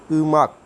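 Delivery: उमक said high and loud; Korean word demo. Shown as a demonstration. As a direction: call out उमक clearly for the class. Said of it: "कैसे पढ़ेंगे?". (Korean शब्द ऊँची आवाज़ में)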